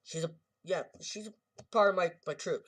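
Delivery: a hint of a proud ape. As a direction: gorilla proudly claiming a friend.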